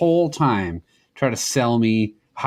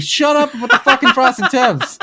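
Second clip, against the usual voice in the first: exasperated retort; 12 semitones higher, 5 dB louder, slower than usual. Retold or imitated. Retold exasperated reply to barber.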